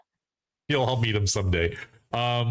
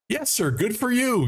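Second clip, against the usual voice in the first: high-pitched, delighted approval; hearty congratulation; 12 semitones higher than usual. Retold or imitated. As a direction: cheer a friend on, voice pitched way up. Acts out own congratulating reply.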